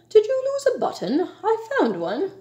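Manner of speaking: high, chirpy little bird voice; small storybook bird, pitched high; sparrow. A narrator's reading. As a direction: pipe up like a tiny sparrow. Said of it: "said the sparrow".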